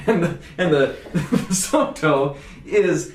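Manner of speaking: giggling through the words; laughing. Real, not acted. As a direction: chuckle while trying to explain. Giggling over the wine name.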